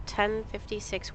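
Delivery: ordinary talk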